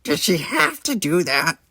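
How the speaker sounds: put-on nasal-free odd Ferengi kid voice; a scheming little alien; sly Ferengi boy. A narrator's reading. Nog suggests eating at his uncle's bar, a sly Ferengi kid voice.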